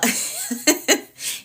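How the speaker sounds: a quick airy laugh; light laugh. Real, not acted. Laughs mid-sentence.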